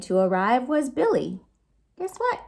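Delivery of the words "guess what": high, rising teasing question; playful tease. A narrator's reading. Storyteller teasing young listeners, high and playful. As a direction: tease the kids before the reveal.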